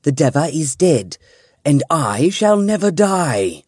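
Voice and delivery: triumphant cry. A narrator's reading. Riljana exulting after the ritual, triumphant cry. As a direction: crow over the victory. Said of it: "Riljana cried".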